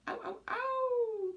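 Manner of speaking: very high, slow drawn-out howl; wolf howl. Shown as a demonstration. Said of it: "there's a snap at the end".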